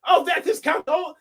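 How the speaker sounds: mock shout. Kids recognising Count Olaf.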